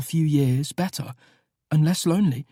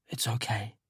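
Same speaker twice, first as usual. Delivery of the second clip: softer, hushed comfort; gentle whispered reassurance; tender reassurance; 6 semitones lower, 10 dB quieter than usual. A narrator's reading. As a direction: soothe him gently in the dark. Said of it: "I reach across Elliot, find his hand, and squeeze".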